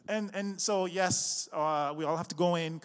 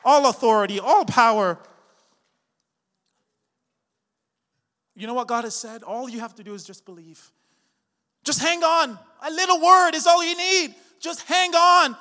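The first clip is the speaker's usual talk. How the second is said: full-force preaching; 7 semitones higher, 16 dB louder than usual. Acted. Pastor preaching to the congregation in full force. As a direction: build the sermon to a roar.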